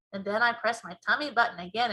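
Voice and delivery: ordinary delivery, nothing marked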